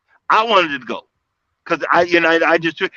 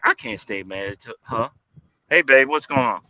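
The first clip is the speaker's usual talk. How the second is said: gentle, softened voice to his wife; sweet and soft; 6 semitones lower, 4 dB quieter than usual. Retold or imitated. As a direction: melt and sweet-talk her.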